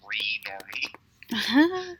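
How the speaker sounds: slow breathy whisper; whispered taunt. Retold or imitated. Kids on the floor whispering a taunt at the man, slow. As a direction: hiss it slowly from the floor. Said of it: "they're just on the floor going".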